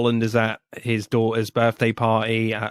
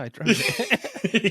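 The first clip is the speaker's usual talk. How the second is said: slow deadpan; 10 semitones higher, much slower than usual. Retold or imitated. Gosling's deadpan answer, retold.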